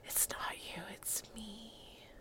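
joking breakup line, whispered. Pretend. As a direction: breathe it like a cheesy breakup.

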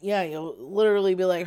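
ordinary delivery

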